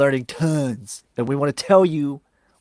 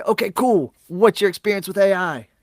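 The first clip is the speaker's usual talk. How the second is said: higher-pitched, upbeat mock sales patter; chipper agent; 7 semitones higher, 4 dB louder than usual. Agent humoring a caller who wants AI, chipper. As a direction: play the eager, agreeable call taker.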